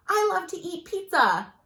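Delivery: high, loud, thrilled exclamation; bright exclamation-mark delivery; excited. Shown as a demonstration. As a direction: say it like pizza is the best thing ever. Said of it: "You're excited about it".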